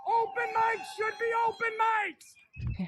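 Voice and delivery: hammed-up protest shouting. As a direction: bellow it like a protest leader. Shouting rant at protest crowd.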